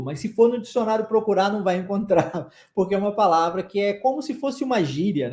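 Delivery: normal talking voice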